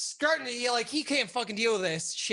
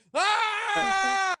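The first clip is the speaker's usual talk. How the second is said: mock furious scream; 12 semitones higher than usual. Boss storming out, screaming in rage. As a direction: let out a furious yell.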